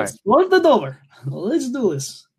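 mock Russian accent. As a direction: kick things off in a hammy Russian accent. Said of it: "you were doing the accent".

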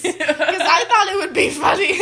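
cracking up at your own prank; laughing confession. Real, not acted. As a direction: say it through giggles.

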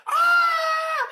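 very high, loud surprised scream; hammed-up scream of surprise; mock shriek. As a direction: let out a startled shriek. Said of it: "they see you".

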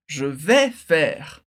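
"je vais faire" slow, with the vowel of "vais" as open è; careful pronunciation. Shown as a demonstration. Teacher showing students the French sound, slowly and deliberately. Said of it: "presta atenção que a pronúncia muda".

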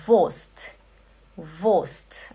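Wurst said as vost, no R; German pronunciation. Shown as a demonstration. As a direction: Wurst with a short o and a silent R, vost. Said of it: "Atenção, não é vo-, não é Wurst".

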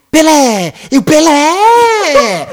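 very high, drawn-out football yell; hammed-up name-shouting. Driver trading player names with Brazilian passengers, hammed-up shouting. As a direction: bellow the name like a goal cry, stretching it. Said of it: "Uma gritaria de nome".

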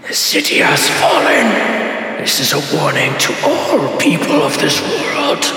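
slow, booming villain proclamation. Acted. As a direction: boom it out like a giant specter. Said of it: "you all hear in a booming voice".

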